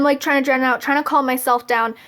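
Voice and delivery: ordinary talk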